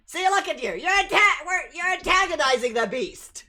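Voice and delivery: high, loud agitated character voice; scolding game character. Acted.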